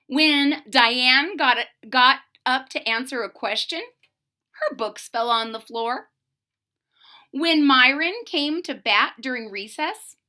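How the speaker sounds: plain reading voice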